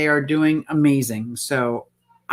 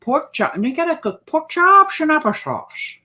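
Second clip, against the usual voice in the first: high sing-song quote; playful quoted catchphrase; quoting a character; 9 semitones higher than usual. Retold or imitated. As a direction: bounce it like a cartoon line.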